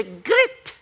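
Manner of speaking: put-on high-pitched, sing-song Welsh accent; playful attempt at a Welsh accent. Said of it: "That's my best Welsh accent".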